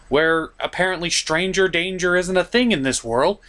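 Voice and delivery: ordinary talk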